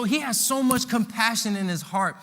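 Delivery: usual speaking voice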